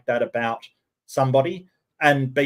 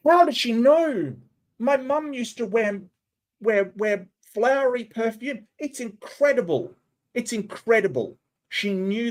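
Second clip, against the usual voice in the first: mock amazed believer; 7 semitones higher than usual.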